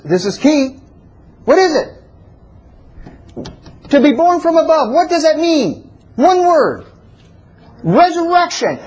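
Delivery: high, insistent call and answer; impassioned preaching. Real, not acted. Preacher quizzing the congregation on resurrection, impassioned. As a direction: drive each question home, voice held high. Climactic preaching on earthly things.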